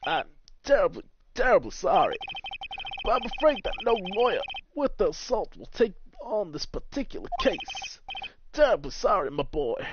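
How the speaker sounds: theatrical lawyer turning down a case; pompous older lawyer. Acted. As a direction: apologise with grand formality.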